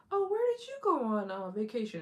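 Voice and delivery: high, bright mock coworker voice; nosy coworker asking about the trip. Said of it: "your coworkers are like".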